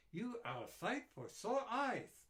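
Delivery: relieved greeting, drawn out; little old doctor. A narrator's reading. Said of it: "she finally said".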